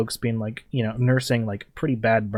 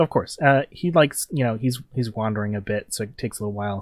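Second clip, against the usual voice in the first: normal talking voice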